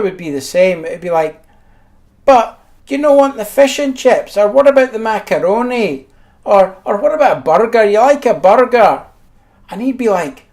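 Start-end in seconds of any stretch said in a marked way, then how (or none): 2.2-9.1 put-on higher, sing-song mum coaxing a child; mock mum voice tempting her kid. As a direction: mimic a mother pushing other dishes. Mother coaxing brother.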